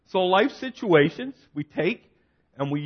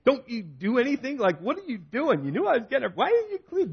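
halfway down the stairs with a freezer, complaining at his wife in a higher, mock-angry voice; retold yelling; 7 semitones higher than usual. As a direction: replay the frustrated yelling lightly, voice climbing high. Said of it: "I'm screaming and yelling".

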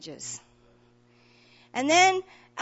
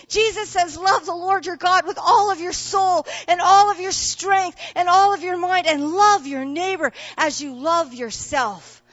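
loud, higher, fervent sermon delivery; a rousing, louder preacher's call to care for others; preaching at full force; 6 semitones higher, 8 dB louder than usual. Acted. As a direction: preach it out to the whole church, climbing. Preacher building to a climax.

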